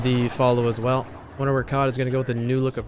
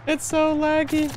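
high, slow whine; high whiny voice; 4 dB louder, slower than usual. Pretend. Repeats line in new voice.

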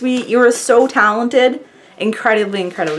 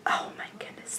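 awed whisper; 19 dB quieter than usual. Real, not acted. Whispered awe at fan's art.

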